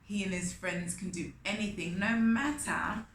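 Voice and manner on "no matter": plain reading voice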